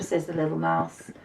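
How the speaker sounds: ordinary delivery, nothing marked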